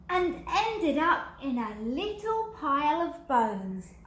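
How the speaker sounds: rising excitement. A narrator's reading. Narrator reading the dog's crash to children, excited and high. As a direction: tell the tumble breathlessly. Dramatic mishap in story.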